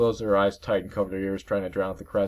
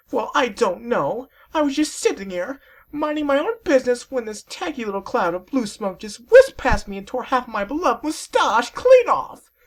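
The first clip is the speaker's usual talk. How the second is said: very high, slow falsetto whine; a vain sea serpent sulking over his lost mustache; high, put-on whimpering voice; weepy dandy serpent; 8 dB louder than usual. Crying serpent complains.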